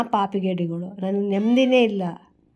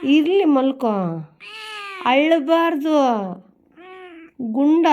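hushing a pet; 6 semitones higher, slower than usual. Real, not acted. Owner hushing crying Gunda to sleep, higher pet-talk voice. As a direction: coax a crying kitten to settle.

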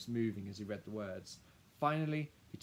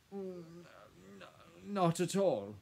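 stammered, higher-pitched denial; flustered gulp; 9 semitones higher, 7 dB louder, slower than usual. A narrator's reading. Lawyer gulping as he answers Lily, flustered. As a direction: stumble into a hasty denial. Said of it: "Mister Sunder gulped".

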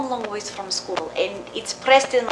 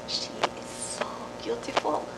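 soft whisper; tender whisper; 5 semitones higher, 8 dB quieter than usual. Acted. Mother praising her little girl in a whisper. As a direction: hush the words with pride.

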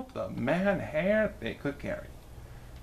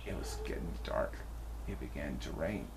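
whispered, much softer and lower; hushed; 11 semitones lower, 11 dB quieter, faster than usual. A narrator's reading. Narrator whispers as the dark rain begins. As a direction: breathe it out like a gloomy secret. Sad rainy night falls.

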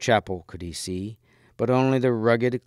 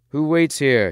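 higher, louder challenge; calling out; 6 semitones higher, 5 dB louder than usual. A narrator's reading. Gawain calls to whoever waits in the chapel, calling out. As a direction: call out to someone unseen. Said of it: "he cried".

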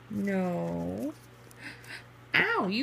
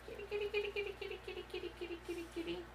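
kitty call; 8 semitones higher, 14 dB quieter, much faster than usual. Real, not acted. Calling the cat, high and soft.

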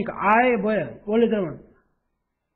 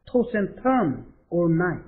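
each word drawn out for learners; slow model read; much slower than usual. Shown as a demonstration. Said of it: "한번 더".

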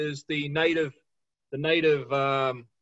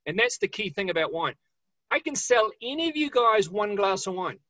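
ordinary delivery

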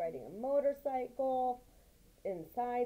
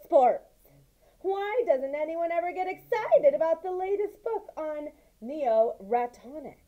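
lively, raised read-aloud voice for the mouse tale; story narration; 6 semitones higher, 7 dB louder than usual. A narrator's reading. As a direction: tell it like a bedtime story, with energy.

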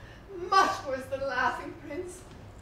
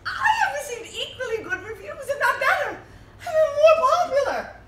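much higher, laughing all through; tipsy laughter; 9 semitones higher, 4 dB louder than usual. Acted. Drunk actress bragging to the prince, high and laughing. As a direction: giggle and boast, getting louder.

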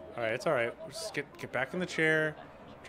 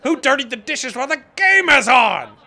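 building to a loud, high furious complaint; angry dad rant; 8 semitones higher, 9 dB louder than usual. Pretend. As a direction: rant like a fed-up dad stuck doing the dishes during the game. Angry dad rant about dishes.

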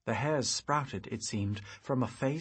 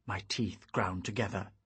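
ordinary delivery

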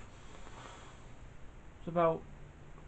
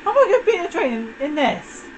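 alarmed disbelief; 9 semitones higher than usual. Real, not acted. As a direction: squeal it in disbelief. Panicking at racing a train.

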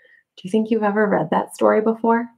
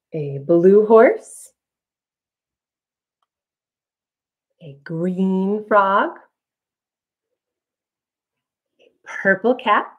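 slow, drawn-out listing for toddlers; reading to a toddler; slower than usual. Acted. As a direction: linger on each animal.

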